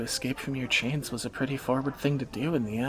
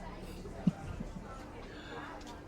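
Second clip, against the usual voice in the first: soft laugh; 11 semitones higher, 10 dB quieter than usual. Acted. Teasing partner about blushing.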